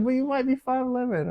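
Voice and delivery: put-on high teasing girlfriend voice. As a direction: needle him in her high voice. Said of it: "She's like".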